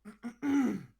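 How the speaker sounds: loud, high throat clearing. Acted. Queen clears her throat at the gawking boy, loud. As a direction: clear your throat to get attention. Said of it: "you clear your throat".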